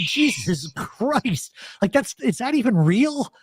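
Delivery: slow, high "Jesus Christ" in shock; stunned disbelief. Retold or imitated. Texting back a friend about the video, stunned and slow. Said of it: "I said".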